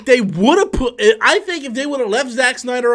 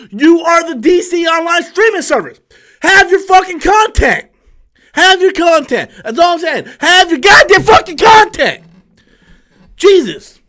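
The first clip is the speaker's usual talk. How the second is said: much higher and louder angry yelling; furious shout; 8 semitones higher, 6 dB louder than usual. Real, not acted. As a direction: explode at the service, demanding content. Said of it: "I don't know why I'm so pissed off".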